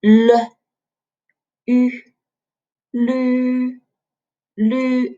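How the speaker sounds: L and rounded U apart, then blended as LU "lü"; pronunciation demo. Shown as a demonstration. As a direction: sound out "l", "ü", then a long level "lü". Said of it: "So pronounce it as".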